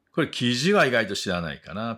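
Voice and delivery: ordinary talk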